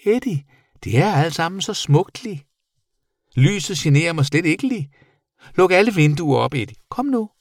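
high, eager creature voice; excited bat. A narrator's reading. Bat begging the boy to open windows, high excitement. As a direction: urge him on, high-pitched.

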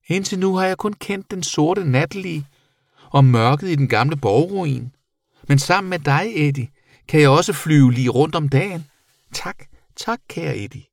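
high creature voice, softening to breathy thanks; grateful bat. A narrator's reading. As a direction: speak high, then hush the thanks.

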